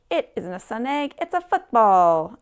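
gleeful shout building to the end. A narrator's reading. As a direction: start moderately, then loud and drawn out. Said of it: "shouted Larch".

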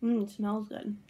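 talking while chewing candy; candy held at lips; mouth partly full. Real, not acted. Seen 2: Speaker unclear; left person holds candy at lips while talking, likely chewing.

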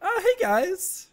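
silly high voice. Acted. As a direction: greet them in a squeaky voice. Voices a character slowly.

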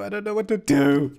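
high, full-voiced helpless wail; panicked, high-pitched outburst; rising panic. Real, not acted. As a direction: let the panic burst out. Panicking louder, second take.

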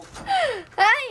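loud bright laugh; high loud laugh. Real, not acted. After the retake, a loud high laugh.

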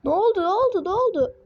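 high, rising-and-falling startled repeats; startled alarm. Real, not acted. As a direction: blurt it in surprise, pitch swooping up. Startled by the game. (şaşkın, telaşlı, tiz sesle)

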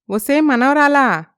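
insistent call. Acted. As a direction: stretch the name out as you shout for her. Grandma calls again louder.

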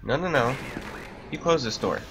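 yelling in terror at the monster; panicking gamer. Real, not acted. Monster appears, player panics loudly.

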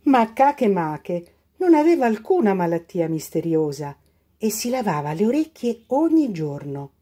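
ordinary delivery

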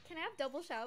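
normal talking voice

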